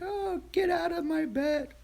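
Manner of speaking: very high, squeaky pet voice; Duffy's voice. Pretend. Dog Duffy pushing her out of bed, squeaky high. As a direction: give the dog a tiny squeaky voice.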